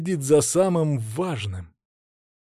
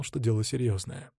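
ordinary delivery

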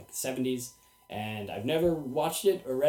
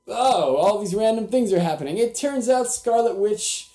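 higher and louder, slowing on the reveal; mock dramatic reveal; 5 semitones higher, 6 dB louder than usual. Summing up a disappointing comic, mock-dramatic reveal. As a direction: ham up the lame plot twist.

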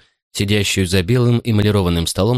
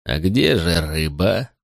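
menacing butler; 3 semitones lower, slower than usual. Acted. Butler's slow menacing question.

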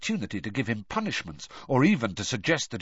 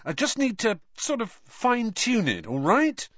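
high-pitched, testy self-defence; cross; 12 semitones higher than usual. A narrator's reading. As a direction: answer crossly, pitch well up. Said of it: "said Harry crossly".

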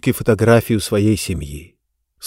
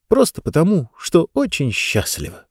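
ordinary delivery

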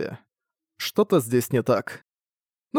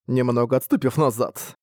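ordinary delivery, nothing marked

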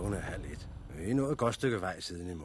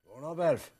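calling out; 12 semitones higher, 7 dB louder than usual. Acted. Calling to wake him.

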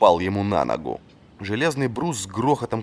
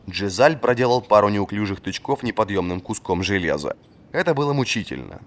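usual speaking voice

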